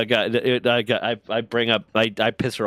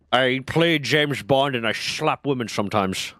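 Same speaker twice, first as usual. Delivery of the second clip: Sean Connery impression; 2 semitones higher than usual. Joking about James Bond, bad Sean Connery Scottish accent. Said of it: "I think it was an attempt at Scottish".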